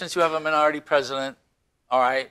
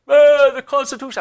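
mock yell, much higher and louder; retold yell; 10 semitones higher, 8 dB louder than usual. Heckler at the polls yelling back, mock shout. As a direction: shout back at him, defiant. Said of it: "He yells".

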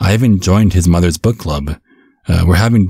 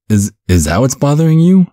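surprised guess; 7 semitones higher than usual. Acted. Father guessing the son's worry.

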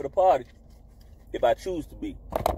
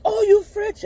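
put-on high, louder girly voice; imitating the woman; 11 semitones higher, 4 dB louder than usual. Woman impressed he speaks French, high and loud. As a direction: squeak it like an impressed girl. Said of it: "She like".

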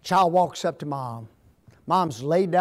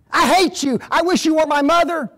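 put-on higher, louder childish fury, a bit fast; child's anger; 9 semitones higher, 7 dB louder than usual. Shown as a demonstration. Preacher acting the child's angry words for the congregation, furious. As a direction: show the raw anger of the kid. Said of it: "It's anger".

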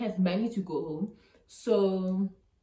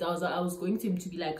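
normal talking voice

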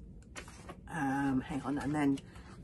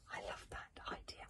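ordinary delivery, nothing marked

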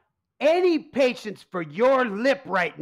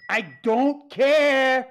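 slow drawn-out dismissal; much slower than usual. Acted. Tony dismissing crusts question, drawn out.

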